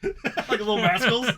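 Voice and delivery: laughing. Real, not acted. Laughing at own bit.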